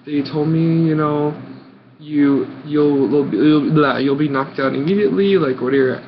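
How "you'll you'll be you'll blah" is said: ordinary talk, nothing marked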